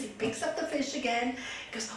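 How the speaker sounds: ordinary delivery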